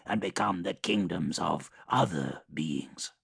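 measured, menacing warlord voice; Mortarion voice. Acted. Mortarion speaks, lower and colder.

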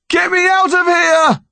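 high desperate shout; panicked yell. Acted. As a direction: scream to be let out. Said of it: "he yelled".

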